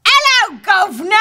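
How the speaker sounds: high, loud "hello governor" British caricature; mock British accent. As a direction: belt out a cartoon British greeting. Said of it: "do as many accents as possible".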